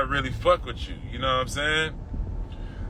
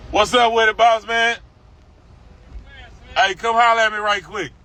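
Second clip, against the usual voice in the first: higher, louder hail across the street; calling out; 7 semitones higher, 3 dB louder than usual. Real, not acted. Hailing an old man from the car, loud and friendly.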